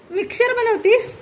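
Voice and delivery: put-on high, amazed question to a child; playful surprise. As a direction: act delighted that she makes a mixer. Mother plays kitchen with child.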